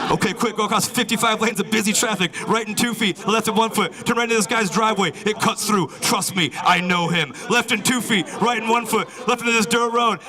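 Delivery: high, frantic rushed directions; frantic app. Pretend. The Waze app dodging cops, high frantic urgency. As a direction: bark turns in a panic. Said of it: "Waze is like".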